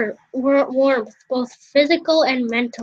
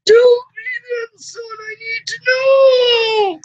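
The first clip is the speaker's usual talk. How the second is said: much higher, loud and slow fatherly voice; father's voice; 9 semitones higher, 5 dB louder than usual. Acted. Father urging his son to tell, high, loud and slow. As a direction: press the son eagerly, as the father. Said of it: "I got it".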